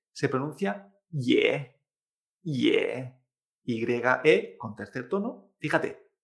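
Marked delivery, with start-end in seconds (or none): 2.4-3.2 "ye" held slowly with a clear Spanish e. Shown as a demonstration. Teacher showing learners a Chinese syllable, "ye" with Spanish E. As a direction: say "ye" with a plain Spanish e, drawn out. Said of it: "como la E española".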